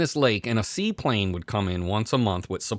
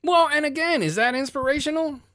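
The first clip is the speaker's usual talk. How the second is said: high-pitched exasperated question; incredulous, worked-up retort; indignant challenge. Acted. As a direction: challenge it in mock outrage, voice way up. Indignantly repeats his earlier rant.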